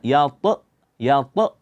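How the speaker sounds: "yat-" with an over-bounced, cut-off t ending; wrong qalqalah. Shown as a demonstration. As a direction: pop the final t of "yat-" into an abrupt extra vowel. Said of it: "Jangan dibaca".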